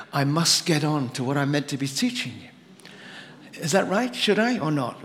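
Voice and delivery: ordinary talk, nothing marked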